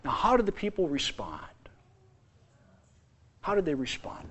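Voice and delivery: hushed whispered question; unvoiced, hushed question to the congregation. Real, not acted. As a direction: draw them in with a whisper.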